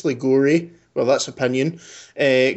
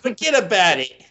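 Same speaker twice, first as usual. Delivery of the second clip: put-on higher-pitched mangled New York accent sliding into Cockney; botched New York, Cockney-sounding; 5 semitones higher than usual. British host put on the spot by co-hosts, bad Cockney-tinged New York accent. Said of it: "that was Co-, Cockney".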